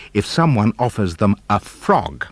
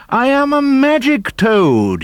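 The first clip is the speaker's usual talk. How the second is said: high, loud, slow toad voice; magic toad cries; 5 dB louder, slower than usual. A narrator's reading. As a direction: proclaim it like a grand little toad. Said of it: "he cried".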